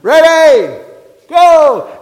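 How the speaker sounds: high, very loud drawn-out shout; blowhorn start call. Retold or imitated. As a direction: holler it like a game marshal starting the round. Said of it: "you hear 'em on the blowhorn".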